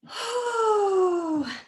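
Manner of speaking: high, crying-like tired sigh. Real, not acted. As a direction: let out an exhausted sigh. Tired sigh during workout.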